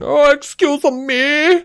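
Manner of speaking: very high, drawn-out cartoon catchphrase; mocking catchphrase impression. Mimicking a character running around with his catchphrase, mocking. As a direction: squeal it slowly like a silly running gag. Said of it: "a catchphrase joke".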